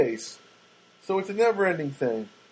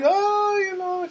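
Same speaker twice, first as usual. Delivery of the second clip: high, slow Czech lady voice; Czech-accented mail carrier, drawn out and high; Czech accent impression; much slower than usual. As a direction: imitate the Czech mail lady, much higher. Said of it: "That's how she talks".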